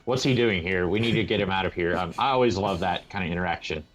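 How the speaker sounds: normal talking voice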